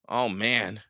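usual speaking voice

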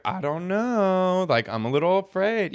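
waver like the nervous one in the group; imitating a reluctant, timid self. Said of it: "I was always the timid one".

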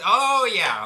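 high, loud acted-out agreement; mock enthusiasm. Answering a coworker about his messed-up friend, hammed-up agreement. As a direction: play up the breezy yes.

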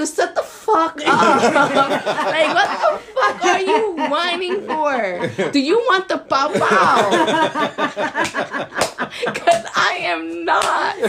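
high, loud comic scolding through laughter; mock exasperated outburst. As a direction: lose all patience with a whining child, playfully. Acted losing patience with whining child.

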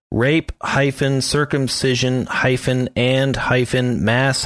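spelling out a web address slowly; slow link read-out. Real, not acted. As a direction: read each piece carefully, unhurried. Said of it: "Can I read off the link?".

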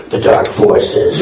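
breathy wheeze on the words; wheezing through the line; wheezy voice. Real, not acted. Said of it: "you may think I'm Darth Vader 'cause I wheezed".

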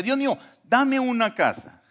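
high, drawn-out plea to God; imploring cry, without shouting; pleading prayer. Retold or imitated. Acts out his old prayer.

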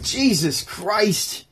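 very slow, playfully exasperated; mock exasperation. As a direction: stretch the name out in comic disbelief. Mock exasperation at Jesus's demand.

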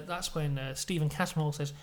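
ordinary talk

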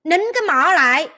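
loud, high exasperated command; angry order. Real, not acted. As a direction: order them to shut up. Orders them to shut up.

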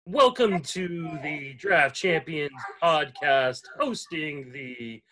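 slow, drawn-out announcer intro; deliberate, ceremonial show opener; podcast host announcement. Acted. Podcast host's opening announcement.

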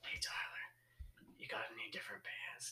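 hushed, unsettling whisper; creepy close-talking manager whisper; imitated close talker. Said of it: "He was a close talker".

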